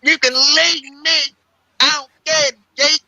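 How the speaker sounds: high, drawn-out pleading whine; whiny mock plea. Teasing a friend, high whiny pleading voice. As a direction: beg dramatically to be abandoned. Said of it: "He said it like".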